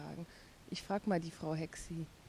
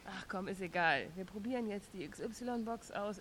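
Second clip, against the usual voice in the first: ordinary talk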